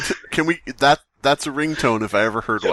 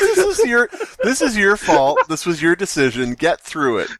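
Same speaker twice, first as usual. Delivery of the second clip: exasperated scolding; 8 semitones higher, 5 dB louder than usual. Real, not acted. Scolding friend to keep reading.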